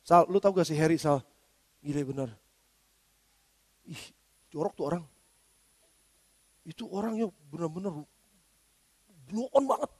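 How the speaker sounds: hushed low gossip to a friend. Retold or imitated. Pastor gossiping to Rizal about another pastor, hushed and low. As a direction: murmur the gossip low and soft, as if telling a secret. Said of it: "Ngomongnya sama Pak Rizal".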